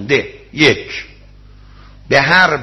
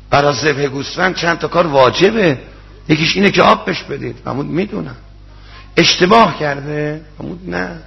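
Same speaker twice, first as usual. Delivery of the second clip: ordinary delivery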